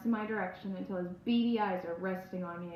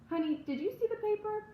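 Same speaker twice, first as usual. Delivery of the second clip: sweet mom voice; 12 semitones higher than usual. Acted. Mother speaking to small child.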